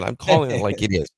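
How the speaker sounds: normal talking voice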